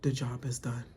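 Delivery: low, soft and solemn; imitating Danielle. Danielle's exit line, low and hushed. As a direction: say it grave and hushed. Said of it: "Danielle just like".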